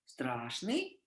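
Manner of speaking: slow, low and soft, "огромный, страшный" drawn out; напряжённое повествование; dramatic storytelling. Shown as a demonstration. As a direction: tell it like a scary tale. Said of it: "in Russian I can say".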